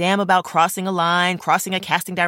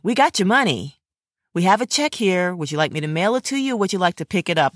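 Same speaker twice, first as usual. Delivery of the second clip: ordinary delivery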